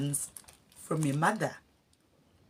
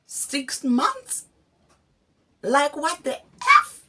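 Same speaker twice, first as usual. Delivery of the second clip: higher, louder disbelief; incredulous cry at six months; real shock at how long it's been; surprised; 12 semitones higher, 9 dB louder, slower than usual. Real, not acted. Disbelief at time apart.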